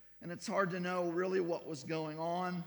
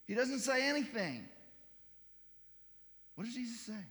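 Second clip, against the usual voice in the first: ordinary delivery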